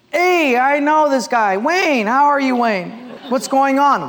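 high, loud greeting; hearty recognition. Acted. Rich man spotting Wayne at the bank, hearty. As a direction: hail an old acquaintance across the room.

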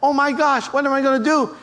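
mock frantic outburst. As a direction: act out a flustered woman in a fix. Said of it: "now she's more frantic".

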